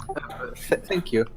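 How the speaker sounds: soft, hesitant stammer; timid, near-whispered reply; shyly grateful. Acted. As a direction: murmur awkward thanks, barely voiced. Shy bard receives a compliment.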